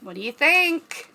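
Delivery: usual speaking voice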